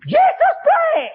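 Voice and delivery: teriakan sangat tinggi dan lambat. Retold or imitated. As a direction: jeritkan panjang-panjang. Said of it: "Dia teriak". (orang itu menjerit "Yesus baik" pelan-pelan)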